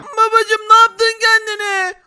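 high, loud put-on kid voice; squeaky astonished child; child's voice. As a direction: play the kid, dragging it out. Voices child seeing armed father.

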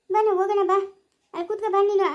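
ordinary talk, nothing marked

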